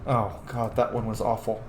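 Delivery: ordinary talk, nothing marked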